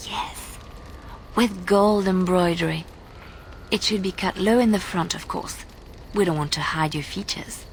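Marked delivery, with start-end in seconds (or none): 0.1-0.6 whispered yes. Acted. Leliana daydreaming over a dress. 5.1-5.7 whispered aside. Acted. Leliana dreaming of a low-cut dress.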